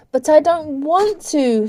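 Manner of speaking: high, slow, wavering protest; reluctant, drawn-out plea; faltering. A narrator's reading. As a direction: protest haltingly. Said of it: "she faltered".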